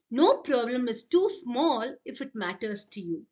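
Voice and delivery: ordinary delivery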